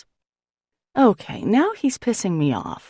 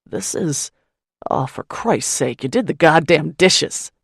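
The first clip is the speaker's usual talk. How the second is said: disgusted gruff man; 5 dB louder than usual. Acted. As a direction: swear it out in disbelief. Malone angry at dishes.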